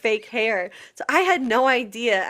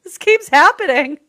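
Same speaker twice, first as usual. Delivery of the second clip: higher, louder laughing surprise; Oh my God, laughing and amazed; retold surprise; 9 semitones higher, 9 dB louder than usual. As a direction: gasp it out, delighted and amazed.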